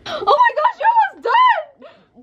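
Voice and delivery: high, loud panicked yell; panicked shout. Real, not acted. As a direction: shout in alarm as she's losing. Sister nearly finishing the challenge.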